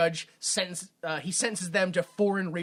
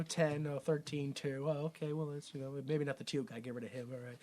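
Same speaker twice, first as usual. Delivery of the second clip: muttered interview; 4 semitones lower, 12 dB quieter than usual. Retold or imitated. Acted interview tailing into mutter.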